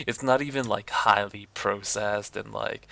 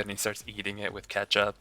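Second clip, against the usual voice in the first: usual speaking voice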